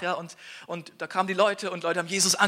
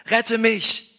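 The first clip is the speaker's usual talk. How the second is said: a high, loud cry for help from a sinking man; desperate, loud and high shout for rescue; panicked scream; 7 semitones higher, 9 dB louder than usual. Acted. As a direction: scream it out as the water pulls you down. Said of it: "schrie er".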